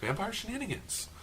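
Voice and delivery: high, teasing repeat of the phrase; mocking echo. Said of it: "That makes it sound less threatening".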